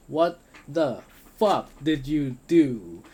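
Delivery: loud, high, slow furious outburst; angry exclamation. Acted. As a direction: demand an answer in rage. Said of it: "they exclaimed".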